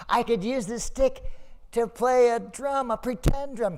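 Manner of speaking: put-on high, tender child voice; grateful little boy. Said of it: "He said".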